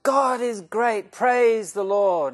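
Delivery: high, loud, drawn-out shout of praise; jubilant cry. Acted. Rabbi from inside the wrecked car, exultant loud cry. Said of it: "you hear this cry from the middle".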